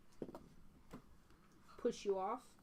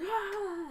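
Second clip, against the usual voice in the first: high, raised cheering cry; short high whoop; crowd cheer; 11 semitones higher, 5 dB louder than usual. Acted. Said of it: "there's a cheer that goes".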